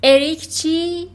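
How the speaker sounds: slow, high barked demand; shouting. Acted. Trunchbull shouting at the boy demanding his name. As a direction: yell the question down at him. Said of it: "ترانچبول داد زد".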